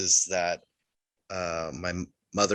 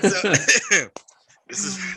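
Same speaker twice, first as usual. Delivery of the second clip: laughing whisper; 10 semitones higher, much slower than usual. Real, not acted. Laughing through tears.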